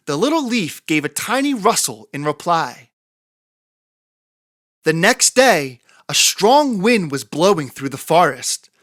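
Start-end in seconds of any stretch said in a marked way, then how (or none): none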